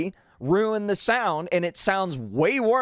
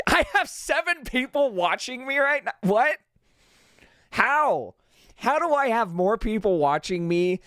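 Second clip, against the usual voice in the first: surprised; 7 semitones higher than usual. Real, not acted. Surprised by viewer count.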